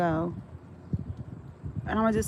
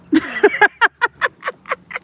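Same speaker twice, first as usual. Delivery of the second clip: high, loud burst of laughter; 14 semitones higher, 9 dB louder than usual. Real, not acted. Laughing at her own story for viewers, loud and high. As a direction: crack up at your own line.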